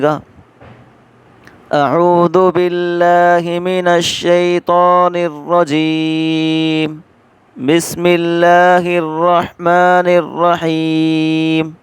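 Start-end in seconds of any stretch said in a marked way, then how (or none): 1.7-11.7 slow, measured tilawat chant; Quranic recitation. Shown as a demonstration. Teacher reciting to open the lesson, slow and chanted. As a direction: recite it slowly, drawing out each word. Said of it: "آپ کو پڑھ کر دکھاتے ہیں".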